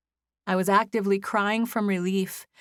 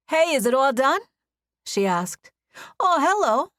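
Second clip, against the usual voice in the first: put-on Boston accent; 8 semitones higher, 5 dB louder than usual. A narrator's reading. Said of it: "She had a heavy Boston accent".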